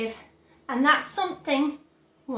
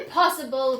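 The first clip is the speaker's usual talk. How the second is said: louder dismissive cry; cried; 6 dB louder than usual. A narrator's reading. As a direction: brush the idea away. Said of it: "cried Mister Wonka".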